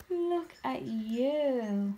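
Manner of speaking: slow, drawn-out cooing. Real, not acted. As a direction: stretch it out admiringly to the spider. Cooing at new pet spider.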